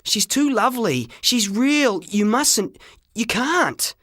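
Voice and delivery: put-on high, pleading young voice; boy begging the shopkeeper, high and desperate. A narrator's reading. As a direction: plead as if your heart breaks. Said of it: "I yelled".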